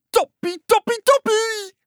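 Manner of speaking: very high, squealed excitement; overjoyed frog cheer. A narrator's reading. Frog delighted with life, overjoyed cheer.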